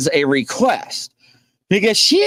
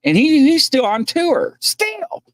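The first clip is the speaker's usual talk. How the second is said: ordinary delivery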